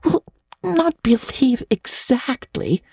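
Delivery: falter, unsure of yourself. A narrator's reading. Anne admitting her ghost fears, faltering softly. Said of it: "faltered Anne".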